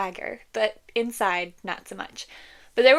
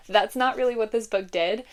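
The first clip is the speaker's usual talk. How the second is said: usual speaking voice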